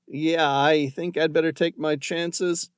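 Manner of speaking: muffled, resigned agreement. Acted. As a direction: say it muffled, half-hidden. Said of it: "In a muffled voice".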